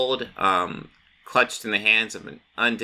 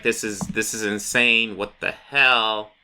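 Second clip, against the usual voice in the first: slow disbelief; slower than usual. Retold or imitated. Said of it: "Lex Luthor's like".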